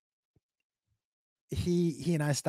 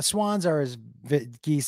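usual speaking voice

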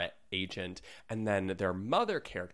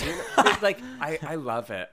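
laughing; 5 semitones higher, 10 dB louder than usual. Real, not acted. Laughing after joke.

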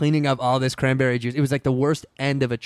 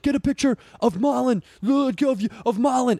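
crowd calling out; 10 semitones higher than usual. Retold or imitated. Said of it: "they were like".

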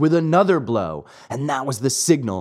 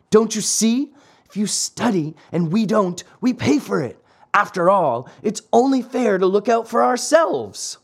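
put-on higher boyish voice, arguing his case; lightly voiced schoolboy; 6 semitones higher than usual. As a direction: explain it like an aggrieved playmate. Boys justify themselves.

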